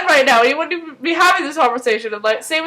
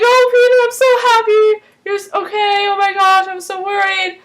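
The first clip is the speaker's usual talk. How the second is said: high, fussy outpouring of relief; mock-emotional high voice; gushing worried Walter; 7 semitones higher than usual. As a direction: imitate an overjoyed, worried father, pitched way up.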